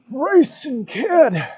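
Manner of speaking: put-on very slow, drawn-out old man call; old man Odin voice. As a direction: stretch the name out like an old god. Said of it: "You like my old man Odin voice?".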